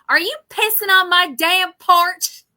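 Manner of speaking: loud, high mock Kentucky accent. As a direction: play a rural Kentucky local catching a trespasser on the porch. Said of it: "kept trying to do that accent".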